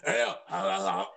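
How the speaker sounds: low, garbled growl of a rapper; deep mock rap-star voice, words lost in noise. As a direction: imitate a caller's low, unintelligible mumbling. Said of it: "he sounded like D M X".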